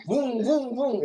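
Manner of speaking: high playful vocal boom, boom, boom for roaring race cars; engine boom imitation. Tutor recalling the F1 race to his student, playful booms. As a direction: mimic the cars roaring past, mouth-noise booms.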